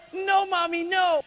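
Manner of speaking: put-on children beg their mother not to diet; whining kids. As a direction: wail it like little kids. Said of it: "they go".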